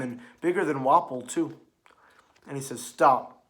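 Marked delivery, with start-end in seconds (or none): 2.8-3.4 sharp command. Acted. Quoting a command in the scene.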